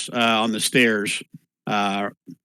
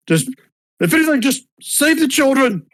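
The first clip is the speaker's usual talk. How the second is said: high, strained cry for help; mock-frantic high call; acted desperate plea; 13 semitones higher than usual. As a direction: plead from downstairs in a pitched-up voice. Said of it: "someone screaming from downstairs".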